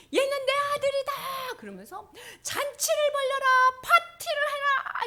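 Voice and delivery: father declaring a party, jubilant; proclaiming father. Acted. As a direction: announce it to the whole house.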